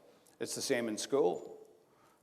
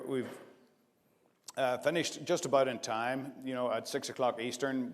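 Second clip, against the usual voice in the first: normal talking voice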